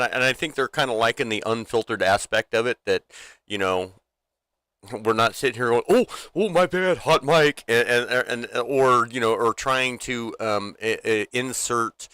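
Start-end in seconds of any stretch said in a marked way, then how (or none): 5.9-7.6 high, mock-flustered apology. Mocking streamers who apologize for swearing, mock-flustered. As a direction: play up a fake on-air slip.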